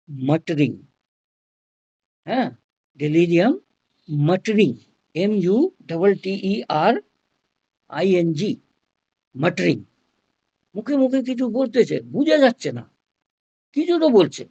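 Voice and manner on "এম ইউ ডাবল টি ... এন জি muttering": usual speaking voice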